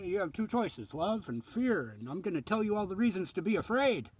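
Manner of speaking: high, hammed-up preachy warning; mock fearmonger voice. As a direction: imitate a fearmonger, pitch pushed up. Mimics fearmongers quoting their pitch.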